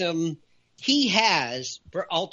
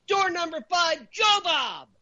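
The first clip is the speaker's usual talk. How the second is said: emphatic shout; 8 semitones higher than usual. Real, not acted. Climax of door list.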